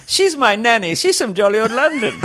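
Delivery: put-on very high, prim little British kid; British-accented child. Kid answering dad's call, high British accent. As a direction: pipe up as a tiny British child. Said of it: "The kid developed a British accent".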